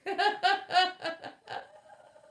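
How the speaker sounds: big real burst of laughter; loud laugh. Real, not acted. Streamer laughing hard at a viewer's comment, loud laugh.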